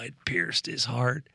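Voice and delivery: ordinary delivery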